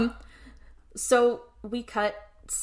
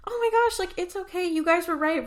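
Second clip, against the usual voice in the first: much higher-pitched mock Anna-style reassurance; gushing quote; 9 semitones higher than usual. Anna reassuring her rescuers, retold in a high gushing voice. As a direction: retell it in a high, put-on voice.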